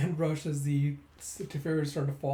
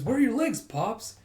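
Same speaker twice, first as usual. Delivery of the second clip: high, loud, baffled outburst; startled question; 8 semitones higher, 5 dB louder than usual. Retold or imitated. Someone seeing Pops without legs, loud acted surprise. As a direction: ask in mock alarm, pitch raised.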